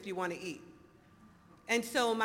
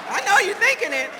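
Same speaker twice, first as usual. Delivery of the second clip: much higher and louder, stress on thinking; near shout; 10 semitones higher, 14 dB louder than usual. Shown as a demonstration. To the audience, shouting the tease even louder. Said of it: "repeats it louder".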